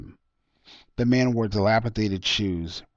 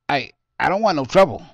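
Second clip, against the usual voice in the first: raised, loud and panicky; scared calling out; 6 semitones higher, 9 dB louder than usual. A narrator's reading. Homeless man, scared at gunpoint, calls out loud and panicky. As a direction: stammer it out, backing away in fear. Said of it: "the man called out".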